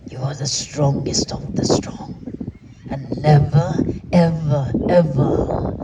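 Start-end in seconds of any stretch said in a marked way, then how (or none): 2.9-5.8 "never, ever, ever" each punched louder with falling tune; insistent repeated emphasis; stress on never ever ever. Acted. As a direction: hammer each "ever" home. Building insistence to audience.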